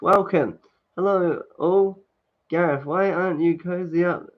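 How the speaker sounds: normal talking voice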